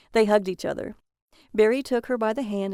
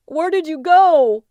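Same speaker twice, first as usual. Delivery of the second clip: slow, much louder and higher scream; 8 semitones higher, 10 dB louder, slower than usual. Acted. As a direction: scream it into the dark, desperate. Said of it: "Barry screamed over and over, weeping".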